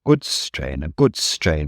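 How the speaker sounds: sulky engine grumbling to himself. Acted. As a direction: grumble like a cross old engine. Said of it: "he grumbled".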